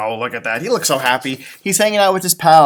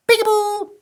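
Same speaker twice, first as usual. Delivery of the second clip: put-on much higher sing-song baby call; playful peekaboo. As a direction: peek out and tease like a little chick. Chick peeks at father.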